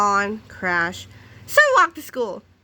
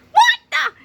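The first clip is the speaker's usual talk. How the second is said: high, loud startled yell; sudden shocked scream; 6 dB louder than usual. A narrator's reading. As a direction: shriek it in shock. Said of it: "he screamed".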